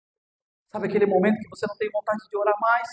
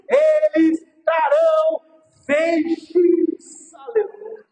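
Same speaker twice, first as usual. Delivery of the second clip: loud, building preacher's cry; preaching at full force; 4 semitones higher, 3 dB louder than usual. Acted. Pastor preaching to mothers on Mother's Day, full force. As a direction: proclaim it to the whole church.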